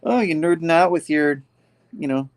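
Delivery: exasperated dig at a nerd; eye-rolling scorn. Retold or imitated. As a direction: say it with a big eye-roll. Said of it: "rolling her eyes like".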